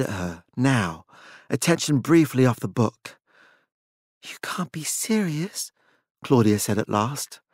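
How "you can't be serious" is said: slow, breathless whisper; barely able to say it; stunned whisper. A narrator's reading. Claudia stunned.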